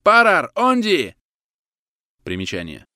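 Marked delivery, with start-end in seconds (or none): 0.0-1.2 loud, high, drawn-out halting cry; shouting. A narrator's reading. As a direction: yell to stop the runners. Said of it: "закричал Григорьев". (кричать высоко и протяжно)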